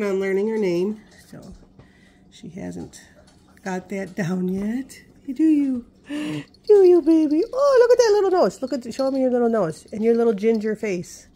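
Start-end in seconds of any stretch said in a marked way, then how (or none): 5.3-9.9 much higher and louder doting pet voice. Real, not acted. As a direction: gush over the puppy. Gushing over the dog.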